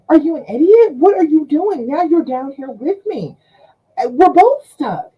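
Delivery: loud incredulous rebuke; exasperated scolding. Acted. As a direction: scold her friend in disbelief. Woman scolds friend in hole.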